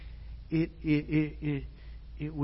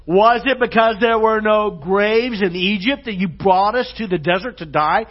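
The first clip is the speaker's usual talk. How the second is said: loud, raised sarcastic quote; big-time sarcasm; 5 semitones higher, 11 dB louder than usual. Acted. Israelites complaining to Moses at the sea, loudly sarcastic. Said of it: "this is like big-time sarcasm".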